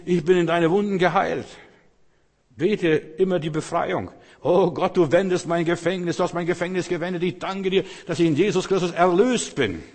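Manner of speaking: high, loud declaration of faith; preaching prayer. Shown as a demonstration. (laut proklamierendes Gebet, hohe Stimme)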